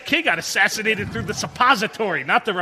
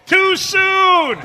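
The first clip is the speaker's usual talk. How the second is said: high, loud, drawn-out yell; yelled mock protest; 13 semitones higher, 9 dB louder than usual. Comedian heckling a friend's Lincoln joke, yelled protest. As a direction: holler it in mock outrage. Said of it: "I would always yell".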